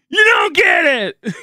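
high, loud, drawn-out yell; frustrated scream. Real, not acted. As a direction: scream it slowly at them. Screams the retort at chat.

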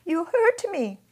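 high, loud, sobbing cry of pain; the child sobbing at the soldier. Acted. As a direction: wail it out through tears. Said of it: "I sobbed".

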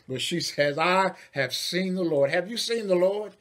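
high, loud, climactic preaching; full-force preaching. Acted. Preacher asking the congregation have you seen the Lord, loud and high. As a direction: shout the good news to the church.

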